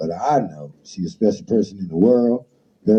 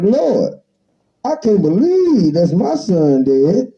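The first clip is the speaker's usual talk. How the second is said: mother's wail; 9 semitones higher, 4 dB louder, slower than usual. Acted. Mother's cry in the toast.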